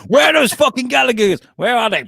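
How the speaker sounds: high, loud, hammed-up demand; mock angry invader. Alien invaders demanding the [name] brothers, mock angry shout. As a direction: shout like an outraged invader on the hunt.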